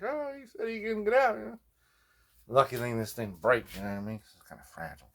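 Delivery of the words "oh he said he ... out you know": much higher, sheepish mock-innocent voice; innocent excuse. The kids innocently explain themselves to the angry aunt. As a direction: play dumb to the aunt. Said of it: "We're like".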